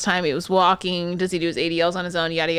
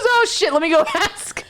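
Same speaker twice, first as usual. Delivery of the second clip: laughing; 8 semitones higher than usual. Real, not acted. Quoting coworker, laughing.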